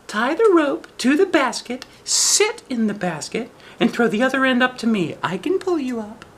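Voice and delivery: put-on very high little girl voice. Shang teases the wolf from the treetop, high girl voice. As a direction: talk up high like a young girl.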